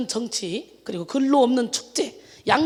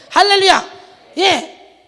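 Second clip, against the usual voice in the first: much louder, higher ringing hallelujah shout; preaching hallelujah; 7 semitones higher, 11 dB louder, much slower than usual. Acted. Preacher stirring the congregation, ringing hallelujah shout. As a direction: lift the whole room with it.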